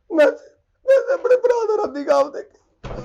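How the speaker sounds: high, slow fake sobbing; theatrical weeping over a dream; mock crying. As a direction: wail it like a hammed-up mourner.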